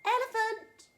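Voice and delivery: high, loud call across the river; shouting out. A narrator's reading. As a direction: crocodile hollering for a friend. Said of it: "So he shouted out".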